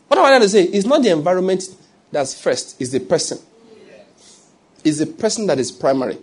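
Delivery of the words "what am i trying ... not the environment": raised, emphatic preacher's question; preaching. Acted. Preacher to congregation, raised emphatic voice. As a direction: drive the point home loudly.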